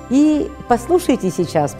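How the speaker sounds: usual speaking voice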